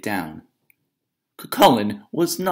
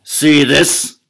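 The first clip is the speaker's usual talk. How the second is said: loud, higher, boastful bellow; bully giant bragging to Una, booming; 6 semitones higher, 10 dB louder than usual. Acted. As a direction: show off the flattened thunderbolt proudly. Giant showing off.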